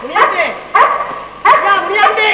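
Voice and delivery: insistent high-pitched calling without extra volume; yelling the name. Acted. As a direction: holler for someone inside the house. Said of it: "ஏன் இப்படி கத்துற?".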